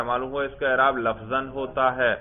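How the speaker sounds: normal talking voice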